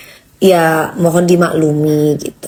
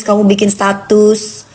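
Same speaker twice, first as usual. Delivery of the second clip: usual speaking voice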